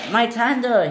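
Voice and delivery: high-pitched cry of dismay. Acted. Engine losing his tender in the water, dismayed cry. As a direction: call out in mild alarm, high.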